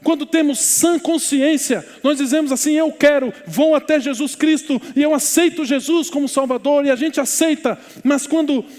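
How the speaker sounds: high, loud, emphatic sermon delivery; full-force preaching. Real, not acted. Pastor tells congregation all are sinners alike, impassioned preaching. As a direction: drive each phrase home to the congregation.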